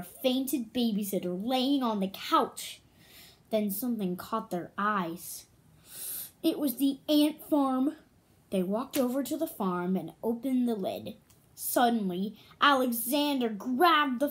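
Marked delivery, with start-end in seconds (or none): none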